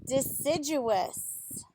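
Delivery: "Deciduous" said slowly, each syllable spelled out clearly; careful pronunciation. Shown as a demonstration. Teaching children the big word "Deciduous", said slowly and carefully. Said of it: "It's a big word".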